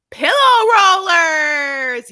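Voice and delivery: rollers! shouted high and loud; excited shout. Real, not acted. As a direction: cry it out in delight.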